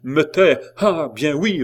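high, loud, scornful comeback; defiant retort. A narrator's reading. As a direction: throw the order back at them. Condemned woman defies order to be silent.

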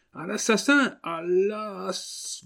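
high, strangled stammering scream; gasping it out under a grip on the throat; choked cry. Acted. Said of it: "bégaya d'une voix étouffée". (cri étranglé et bégayé)

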